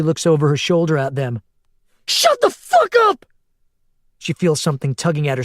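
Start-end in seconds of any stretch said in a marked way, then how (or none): 2.1-3.2 very high, loud, slow raging scream; woman turning to scream at the swarm; furious scream. A narrator's reading. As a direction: scream at the monsters to silence them. Yells at swarm behind fence.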